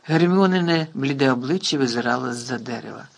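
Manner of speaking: ordinary delivery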